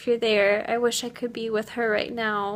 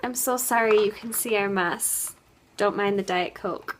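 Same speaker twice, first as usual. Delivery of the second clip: ordinary delivery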